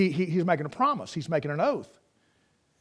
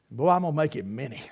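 ordinary talk

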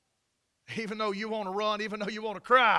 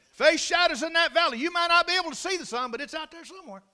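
high, raised exhortation, easing at the end; fervent preaching; 8 semitones higher, 6 dB louder than usual. Real, not acted. Preacher promising sun beyond the shadowed valley, fervent. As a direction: lift the voice to assure the congregation.

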